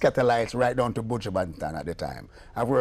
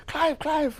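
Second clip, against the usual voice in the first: high, eager calling of a name; excited calling. Retold or imitated. The lady runs to Clive, excited high calling. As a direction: call out to him, thrilled. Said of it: "she run to me".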